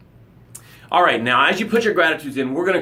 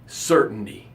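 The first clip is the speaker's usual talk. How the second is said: ordinary talk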